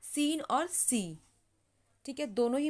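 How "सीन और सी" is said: very slow, each word drawn out; teacher reviewing the words slowly; slow word list. Shown as a demonstration. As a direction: read चिक, मीट, सीन and सी slowly. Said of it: "तो sound भी देख सकते हैं आप".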